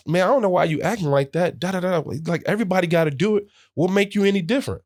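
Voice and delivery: high, quick mocking teammate voice; needling teammate. Teammate needling rookie about paying, high and fast. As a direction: goad him, fast and high.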